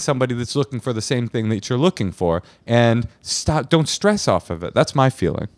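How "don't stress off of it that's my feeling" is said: ordinary delivery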